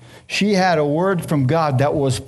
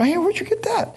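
put-on way up high, a jealous little boy's voice; envious kid. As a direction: squeak it out like a jealous kid brother. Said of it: "I go".

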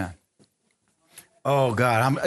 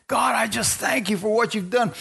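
higher, lifted voice of David thanking God; voicing David; 6 semitones higher than usual. David thanking God while he dances, in a raised voice. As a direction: lift up into a praising David.

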